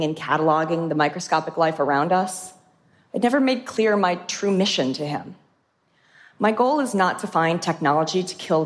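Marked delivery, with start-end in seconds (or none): none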